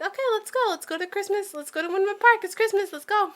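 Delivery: high, eager urging. Retold or imitated. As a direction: hurry everyone along, high. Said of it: "and then I'm like".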